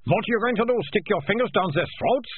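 high-pitched, disbelieving official; incredulous mayor. A narrator's reading. Mayor doubting the boy can retrieve food, incredulous. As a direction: question it in a high, fussy voice.

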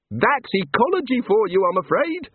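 high, bright small-god voice; cheerful little god. A narrator's reading. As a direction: play an eager little craftsman god. God character voice.

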